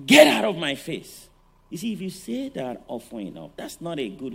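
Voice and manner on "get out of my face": sudden loud bark of a harsh parent; shouted quote. Retold or imitated. Preacher voicing a parent yelling at a child, loud. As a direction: blast it like a fed-up parent. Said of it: "Don't say".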